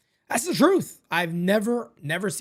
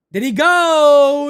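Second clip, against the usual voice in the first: excited call; 10 semitones higher, 11 dB louder, much slower than usual. Real, not acted. Checking the swing live.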